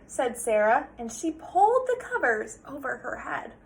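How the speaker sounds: ordinary delivery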